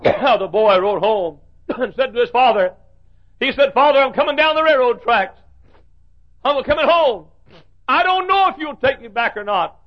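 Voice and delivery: high, loud, ringing sermon voice; preaching at full force. Acted. Preacher at full force telling the prodigal's plea. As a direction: ride the climax of the story.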